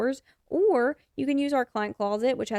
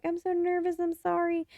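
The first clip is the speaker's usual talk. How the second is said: high, timid apologetic client voice; nervous client; 8 semitones higher than usual. Retold or imitated. Client arriving at the studio, mock nervous apology. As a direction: play the jittery new client saying sorry. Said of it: "They're like".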